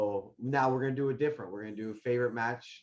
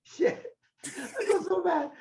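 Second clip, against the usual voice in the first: high, drawn-out squeal of laughter; laughing hard; slower than usual. Real, not acted. Friend laughing over the ticket mishap, high and laughing. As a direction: squeeze the words out through helpless laughing.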